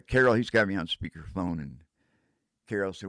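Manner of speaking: normal talking voice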